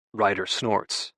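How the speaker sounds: ordinary delivery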